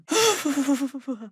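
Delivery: a sharp startled gasp. Acted. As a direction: gasp in sudden shock. Sudden gasp in game.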